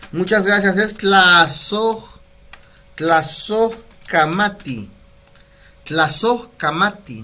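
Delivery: tlazohkamati drawn out slowly, syllable by syllable; slow Nahuatl demo. Shown as a demonstration. Teacher slowly modelling thanks in Nahuatl. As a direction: sound out tlazohkamati carefully.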